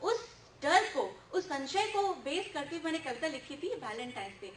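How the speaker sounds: normal talking voice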